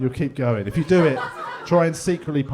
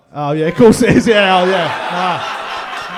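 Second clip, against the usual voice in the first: loud sarcastic drawn-out yeah; mock him loudly and slowly; 5 semitones higher, 12 dB louder, much slower than usual. Real, not acted. Comic loudly mocks the man who failed, sarcastic.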